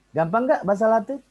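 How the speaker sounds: usual speaking voice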